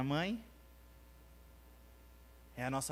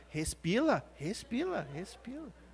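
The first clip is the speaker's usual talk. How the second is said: put-on quoting a child; 2 semitones lower, 8 dB quieter than usual. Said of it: "Aí ele falando".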